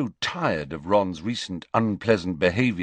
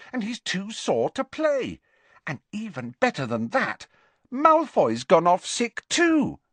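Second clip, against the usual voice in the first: put-on higher girl's voice, delighted gossip; gleeful Ginny; 12 semitones higher than usual. A narrator's reading. Ginny telling the team the news, gleeful. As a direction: share good news with relish.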